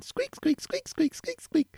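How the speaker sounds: high squeaky chair noise; mimicking the chair squeaking side to side; squeaky chair imitation. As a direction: squeak like a creaky office chair.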